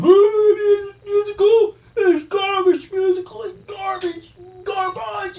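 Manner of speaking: mocking everyone. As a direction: squeal it like a sneering critic. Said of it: "And everybody's like".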